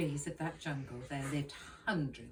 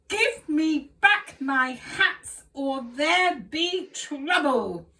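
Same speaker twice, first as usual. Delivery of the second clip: loud, higher, slow threatening shout; angry threat; 9 semitones higher, 12 dB louder, slower than usual. Acted. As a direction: wave the stick and yell the warning. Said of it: "The man was even angrier".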